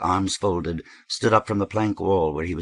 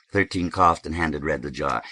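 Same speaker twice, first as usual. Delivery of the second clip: usual speaking voice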